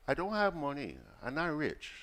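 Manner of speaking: mildly plaintive put-on voice, no sobbing; tearful vendor woman. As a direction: imitate a poor country woman pleading. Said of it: "this lady started crying".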